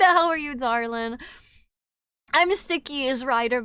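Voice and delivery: ordinary delivery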